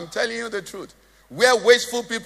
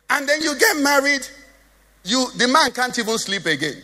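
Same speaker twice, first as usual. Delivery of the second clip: ordinary delivery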